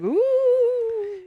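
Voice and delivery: a spooky ghost moan, drawn out; ghostly ooh. Retold or imitated. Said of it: "Why do I keep sounding like a ghost?".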